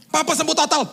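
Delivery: high, loud overjoyed announcement; excited caller. Retold or imitated. Relative telling pastor of father's recovery, acted excitement. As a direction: imitate a thrilled relative.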